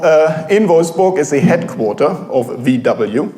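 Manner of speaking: ordinary delivery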